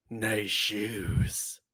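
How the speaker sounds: creepy wrestler line. Retold or imitated. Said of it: "their feet and goes".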